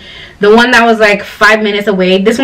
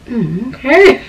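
a flat, unimpressed "Mm, okay" from the hairdresser, with a hint of a laugh; dismissive mock okay; 4 semitones higher, slower than usual. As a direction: imitate the hairdresser brushing her off with "Mm, okay". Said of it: "She's just".

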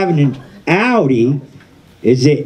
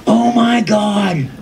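mock yell; 8 semitones higher, much slower than usual. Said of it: "she starts yelling".

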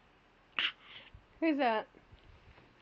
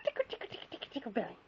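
whispered tickle; 8 semitones lower, 13 dB quieter, much faster than usual. Real, not acted.

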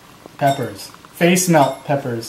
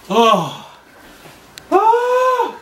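sudden high yelp from the burn; pained cry. Real, not acted. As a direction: cry out from the spice. Dad reacting to heat.

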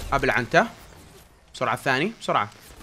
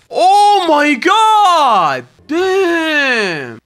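high, loud, slowly stretched oh my god; real amazement; amazed; 8 semitones higher, 5 dB louder, much slower than usual. Real, not acted. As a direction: marvel at the loot. Amazed at loot pile.

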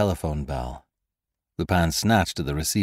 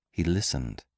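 usual speaking voice

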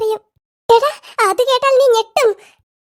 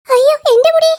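higher, rising yelp of alarm; alarmed cry; 5 semitones higher, 4 dB louder than usual. Acted. Listener fearing their own hair vanished, alarmed cry. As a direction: cry out in sudden panic. Panic at maybe missing hair.

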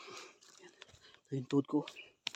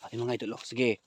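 ordinary talk

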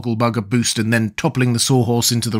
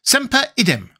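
high, pompous scholar; pompous Wogglebug; 13 semitones higher than usual. A narrator's reading. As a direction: pronounce the Latin pompously, pitched high. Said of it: "explained the Wogglebug pompously".

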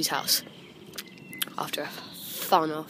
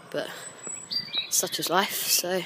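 normal talking voice

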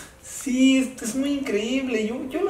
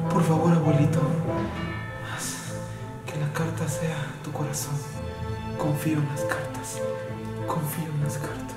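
hushed prayer; 8 semitones lower, 8 dB quieter than usual. Acted. Quiet prayer before drawing.

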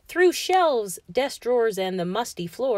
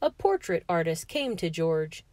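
plain reading voice

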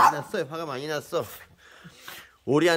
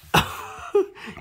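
breathy laugh. Real, not acted. Gives up intervening.

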